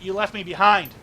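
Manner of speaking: loud, bitter accusation, punching behind. Acted. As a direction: hurl the blame at him. Wu accuses old friend.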